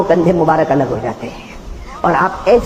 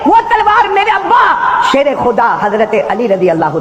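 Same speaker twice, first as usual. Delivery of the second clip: impassioned preaching; 12 semitones higher than usual. Acted. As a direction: preach it out to the gathering. Preaching the Karbala story. (پرجوش خطابت، بلند آواز)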